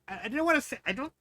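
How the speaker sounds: usual speaking voice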